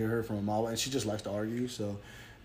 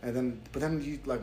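ordinary talk, nothing marked

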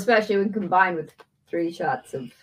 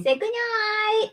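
sleepy talk to pet; 14 semitones higher, slower than usual. Real, not acted. Voicing to the cat.

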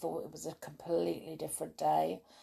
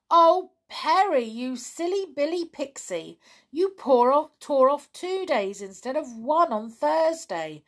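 excited higher shout; 8 semitones higher, 7 dB louder than usual. Acted. Pipkin shouting at Perry while dancing around, excited. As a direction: call it out, delighted. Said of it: "shouted Pipkin".

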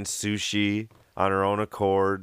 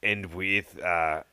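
slow Scottish brogue, drink order like Bond; Scottish Bond accent, drawn out; Sean Connery Scottish accent; 3 semitones lower, slower than usual. Pretend. Guest imitating Sean Connery ordering a drink, mock Scottish accent. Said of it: "Sean Connery".

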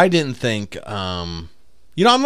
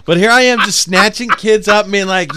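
normal talking voice

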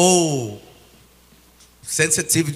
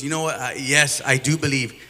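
ordinary talk, nothing marked

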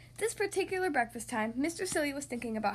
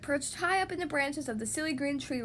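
ordinary delivery

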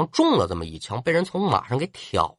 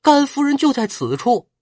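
sudden cry; 9 semitones higher than usual. Acted. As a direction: blurt it out as if shocked. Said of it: "突然惊叫一声".

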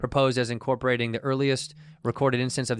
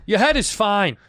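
dad voice; 7 semitones higher, 5 dB louder, slower than usual. Retold or imitated. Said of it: "our generation dad thing".

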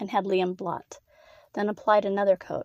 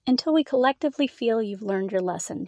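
usual speaking voice

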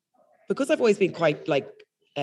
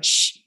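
long hissed shush; loud shush; 4 dB louder than usual. Retold or imitated. Embarrassed on phone as people stare, loud shushing. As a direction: hush someone with a sharp shh. Acting out hushing passers-by.

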